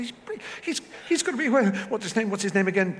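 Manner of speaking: high-pitched, stumbling enthusiasm; excited raving. Retold or imitated. Director raving to casting director about an actor, excitedly. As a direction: trip over your words, pitched right up.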